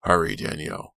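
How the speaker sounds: plain reading voice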